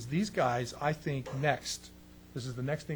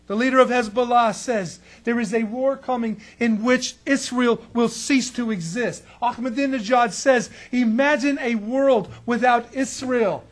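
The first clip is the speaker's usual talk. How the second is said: higher, louder pulpit preaching; preaching at full force; 7 semitones higher, 10 dB louder than usual. Acted. Preacher warning his congregation about Israel's enemies, preaching loud and high. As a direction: drive it home to the congregation.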